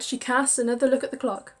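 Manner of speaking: ordinary delivery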